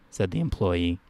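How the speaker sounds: usual speaking voice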